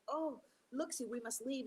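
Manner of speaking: Possum begging his friend to run; high worried animal voice; alarmed Possum. Acted. Possum urges Turtle to flee.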